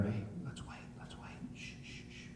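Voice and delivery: hushed, urgent-sounding ambush whisper. Retold or imitated. Men lying in ambush to one another, whispering. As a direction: whisper and shush each other. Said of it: "they're whispering to one another".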